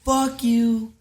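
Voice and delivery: put-on loud, high drunken yell at the desk worker; angry yell. Retelling yelling at the dorm desk worker, loud and angry. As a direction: shout it in her face. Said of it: "I said".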